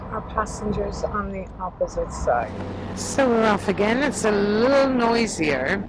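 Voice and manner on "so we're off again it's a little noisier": talking loud over boat engine noise; raised voice. Real, not acted. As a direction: project over the noise on deck. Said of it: "I'm doing my A S M R voice at the moment".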